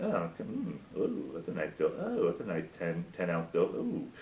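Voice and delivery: very soft, low savouring voice; hushed, drawn-in oohs of playacted delight; mock sensual murmur. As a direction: murmur with pretend pleasure. Acts out patting dough with pleasure.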